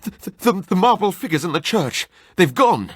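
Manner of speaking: high, halting, frightened stammer; panicked man stuttering out the horror; stammering fright. Acted. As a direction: blurt it out through terror. Terrified man stammering news.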